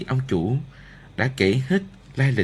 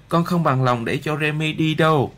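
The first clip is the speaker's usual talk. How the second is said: put-on higher, insistent boy's voice; protesting child; 6 semitones higher than usual. A narrator's reading. Arthur objects to his mother about Remy leaving, protesting child.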